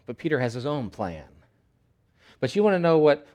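ordinary talk, nothing marked

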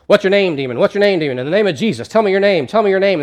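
loud, high, insistent demands; exorcist priest. Acted. Exorcist priest fighting a demon, loud and commanding. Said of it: "this priest who's an exorcist".

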